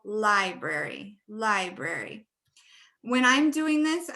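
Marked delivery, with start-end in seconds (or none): 0.0-1.2 "library" slowly, LI stretched out; first-syllable stress. Shown as a demonstration. Teacher models the underlined syllable, stressed LI in "library". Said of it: "we have that first syllable". 1.3-2.3 "library", slow and lower, long LI; first-syllable stress. Shown as a demonstration. Teacher repeats the example, first syllable stressed in "library". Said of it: "we have that first syllable".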